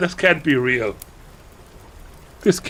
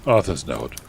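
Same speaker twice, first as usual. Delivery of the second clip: usual speaking voice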